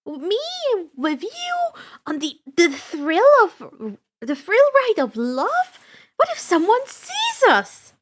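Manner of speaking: high, loud embarrassed stammer; flustered girl. A narrator's reading. Annabeth flustered at riding the love boat with Percy. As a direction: sputter it out, mortified.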